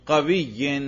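قوی said as "qawiyyin"; genitive ending. Shown as a demonstration. Teacher modelling jar for pupil, genitive ending. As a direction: end قوی in "-in" over a doubled y. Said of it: "جر پر کرو".

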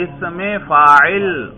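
"اس میں فاعل" very slowly, each word stretched; drawn-out emphasis. Shown as a demonstration. Teacher showing that the name explains itself, "اس میں فاعل" slow and emphatic. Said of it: "نام سے پتہ چل رہا ہے".

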